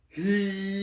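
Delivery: short high tight vocal demo; a clipped practice syllable; voice-training demo syllable; demo sound. Shown as a demonstration. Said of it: "You see the difference?".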